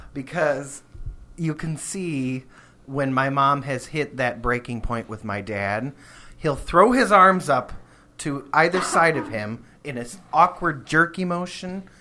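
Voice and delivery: ordinary delivery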